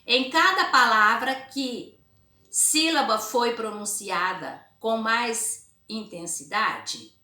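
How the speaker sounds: emphatic, drawn-out teacher read-aloud; slow reading aloud. Acted. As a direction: read it out word by word for pupils.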